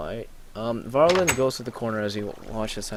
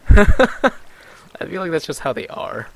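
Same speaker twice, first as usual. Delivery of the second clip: amused aside; 6 semitones higher, 12 dB louder, slower than usual. Acted. Laughing in-character aside.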